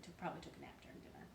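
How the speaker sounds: fast breathy aside; whispered aside. Real, not acted. Storyteller unsure of the plot, a fast whisper. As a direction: rush it under your breath.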